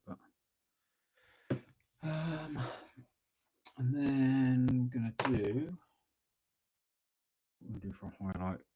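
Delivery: talking around a paintbrush; brush in mouth. Real, not acted. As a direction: mouth half blocked by a brush. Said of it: "I'm talking with the brush in my mouth".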